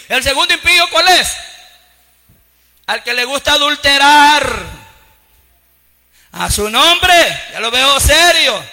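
loud, rising sermon cries to the congregation; full-force preaching. Acted. Pastor preaching to his flock, loud and fervent. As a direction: preach it hard, voice ringing out.

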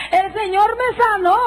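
high, slow ecstatic cry of healing; retold cry. Healed woman in church proclaiming, high and slow. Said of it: "se meneaba y temblaba".

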